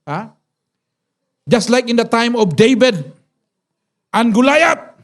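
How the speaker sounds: normal talking voice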